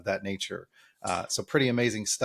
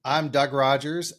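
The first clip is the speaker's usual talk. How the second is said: ordinary talk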